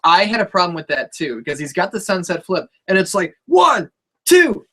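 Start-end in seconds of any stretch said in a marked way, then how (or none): 3.5-4.7 high, loud count-out of each number; referee's count. Retold or imitated. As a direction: call the pin count like a referee. Mimics a referee's pin count.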